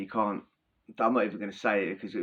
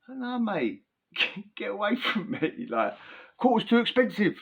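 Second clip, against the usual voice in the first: higher, laughing no mate; turning down the offer while cracking up; laughing refusal; 10 semitones higher than usual. Retold or imitated. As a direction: laugh through the brush-off. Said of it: "my sides are splitting".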